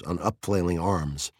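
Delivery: usual speaking voice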